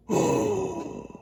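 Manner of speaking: big shocked gasp, very high; sharp high gasp. Acted. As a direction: gasp in alarm at the dogs. Dogs drinking goldfish water.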